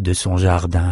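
plain reading voice

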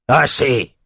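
sharp cutting "Assez!"; cutting order. Acted. General silencing crude rowers, sharp cut-off. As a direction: stop them dead. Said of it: "coupa Outros".

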